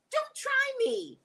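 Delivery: don't try me pulled long and high up top; a mock warning sung slow and high; sassy warning, drawn out high. Real, not acted. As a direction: stretch it slow and high, daring them. Repeated warning to chat, drawn out.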